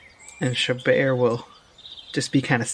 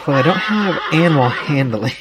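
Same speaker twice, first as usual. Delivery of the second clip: normal talking voice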